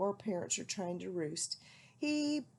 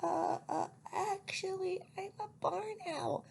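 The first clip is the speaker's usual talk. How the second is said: high little owlet voice; small owl explaining, pitched high; 10 semitones higher than usual. A narrator's reading. Owlet explaining to old lady, high.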